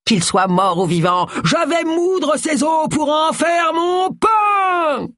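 slow ogre shout, soaring high; high, stretched-out pantomime ogre bellow; roaring ogre. A narrator's reading. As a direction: roar the threat like a stage ogre. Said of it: "rugit Bert Simple".

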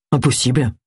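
mildly acid retort; a touch of bitterness; sour. Acted. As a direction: dismiss it sourly. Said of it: "s'exclama Agatha avec aigreur".

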